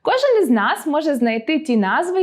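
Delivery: ordinary talk, nothing marked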